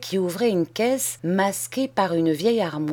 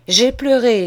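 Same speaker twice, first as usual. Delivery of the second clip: ironic outburst; 5 semitones higher, 7 dB louder than usual. Acted. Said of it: "Charles sourit ironiquement".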